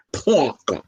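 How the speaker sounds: Parker with a broad New York accent, dropped r. Shown as a demonstration. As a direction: say Parker like a New Yorker, Pawker. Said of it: "That's my New York accent".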